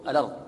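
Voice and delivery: "الأرض" said with the hamza dropped and its vowel moved onto the lam; "الأرض" with no glottal stop; with hamza transfer. Shown as a demonstration. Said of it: "أن تنقل الهمزة إلى الساكن". ("الأرض" بنقل حركة الهمزة إلى اللام)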